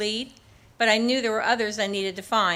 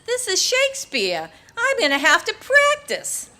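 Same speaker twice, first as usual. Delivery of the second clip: much higher, amused imitation of a Boston man; Boston accent; 11 semitones higher, 4 dB louder than usual. The Marine worrying about reading Shakespeare, amused Boston accent. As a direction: play Eric fretting comically about the reading. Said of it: "because of his Boston accent".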